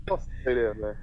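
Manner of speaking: ordinary talk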